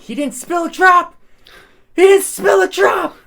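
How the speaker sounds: triumphant shout. Retold or imitated. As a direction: crow it like the drunk buddy cheering a miracle. Drunk friend cheering the fall.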